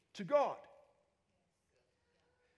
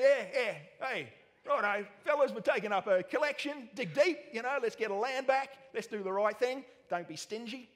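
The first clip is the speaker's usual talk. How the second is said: louder, higher mock Aussie bloke rallying the fellas; Aussie accent; 4 semitones higher, 5 dB louder than usual. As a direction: pass the hat in a hint of broad Australian. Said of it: "And you think".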